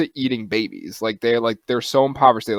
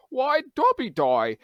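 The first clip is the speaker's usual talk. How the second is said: high, drawn-out mock British accent; slow, high-pitched British quip; slower than usual. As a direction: imitate a heckler in a hammy British accent. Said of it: "in a British accent".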